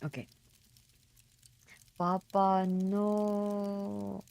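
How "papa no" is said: louder, milder replay of the cry; half-hearted tearful plea; dramatic plea. Acted. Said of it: "trying to hold my tears".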